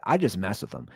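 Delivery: usual speaking voice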